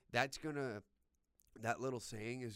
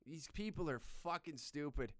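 usual speaking voice

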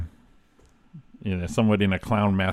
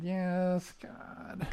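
reluctant groan; 9 semitones higher, 12 dB quieter than usual. Retold or imitated. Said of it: "I said".

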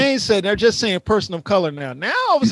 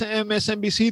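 normal talking voice

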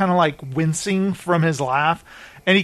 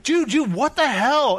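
higher, mock-shocked "dude, dude"; shocked outcry; 8 semitones higher than usual. Crew members react to Travis slapping her, shocked. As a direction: play the stunned crew member.

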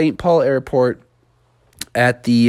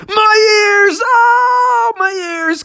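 high shouted cry; pained shout; 3 dB louder, much slower than usual. Acted. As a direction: yell as the ears pop. Ears popping on ascent.

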